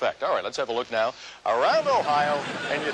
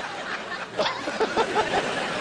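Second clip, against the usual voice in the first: high giggle. Real, not acted. Anchor laughing on air.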